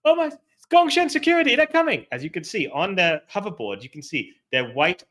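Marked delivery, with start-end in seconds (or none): 0.1-2.1 high, loud alarmed yell; panicked shout. Acted. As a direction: shout a frantic warning. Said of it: "someone starts shouting".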